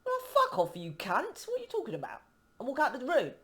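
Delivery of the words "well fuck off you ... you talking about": high-pitched outraged comeback; swearing in high mock outrage at the rude man; indignant retort. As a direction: fire it back, outraged and squeaky.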